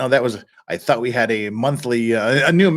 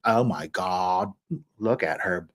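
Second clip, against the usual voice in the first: put-on low Valley Girl vocal fry; 7 semitones lower, 7 dB quieter, slower than usual. Shown as a demonstration. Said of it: "a little vocal fry".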